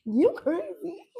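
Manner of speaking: high, slow laughing "you crazy"; laughing tease. Real, not acted. Teasing the host about early hours, laughing high.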